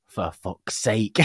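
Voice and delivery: put-on slow, drawn-out "For fuck's sake" like a small child; slow child imitation. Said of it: "he hears from the bedroom door".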